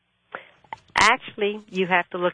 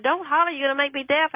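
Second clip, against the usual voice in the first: retold holler; 8 semitones higher than usual. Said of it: "he hollered".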